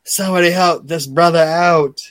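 mock earnest plea for help; hammed-up call for help; Owen Hart impression. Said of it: "Owen Hart stands up and goes".

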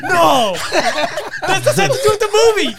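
loud, high, laughing through words; laughing protest. Real, not acted. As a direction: protest while cracking up. Laughing protest over shot.

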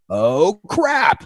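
sudden oh crap realization; dismayed. Retold or imitated. Quoting their realization.

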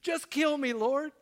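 loud, high mock-desperate cry; Jonah's despairing plea. Jonah begging the Lord after the worm, melodramatic despair. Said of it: "That's what he says".

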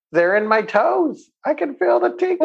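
lifted high, wondering voice for the doctor; amazed retelling. Retold or imitated. Narrating the doctor telling her the tingling reached his toes, in an amazed high voice.

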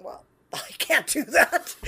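laughing through a retold protest; a giggly, helpless I can't. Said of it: "I was going".

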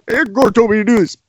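high, fast dopey excuse; a bit of a dim defendant; mock defendant.

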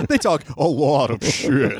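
slow horse voice through laughter; a laughing talking horse; bad Mister Ed. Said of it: "It's a bad Mister Ed".

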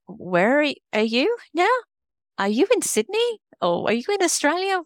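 high-pitched mock puzzled questioning; mimicked wide-eyed curiosity, very high; puzzled clients. Mimics clients puzzled by her accent.

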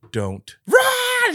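urgent cry of don't run; desperate shout. Retold or imitated. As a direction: call out in panic, breaking. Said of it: "he's like".

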